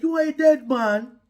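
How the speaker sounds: God warns the king again; God's warning. Retold or imitated. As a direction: boom it down from heaven. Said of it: "God told Abimelech".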